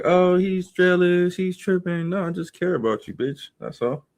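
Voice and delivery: ordinary delivery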